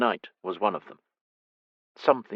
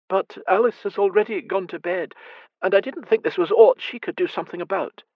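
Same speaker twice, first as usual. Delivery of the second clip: higher, worried Scottish lady; the fretful mother at night; lightly higher mother, uneasy; anxious blind mother; 11 semitones higher, 3 dB louder than usual. Acted. Mother's voice in narration.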